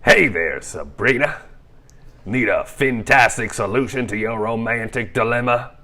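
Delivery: loud, brash New York wise guy; New York accent. Acted. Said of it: "With a New York accent".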